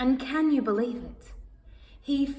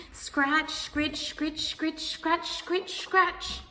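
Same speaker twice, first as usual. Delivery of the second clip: scratching noises; 5 semitones higher, much slower than usual. Acted.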